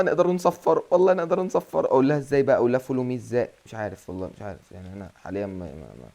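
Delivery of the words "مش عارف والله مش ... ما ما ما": much softer and lower, unsure; quiet mumble. Real, not acted. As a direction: trail off to yourself, puzzled. Unsure how to command. (همهمة هادئة ومترددة)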